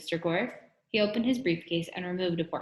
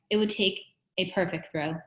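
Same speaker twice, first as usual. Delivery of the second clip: ordinary delivery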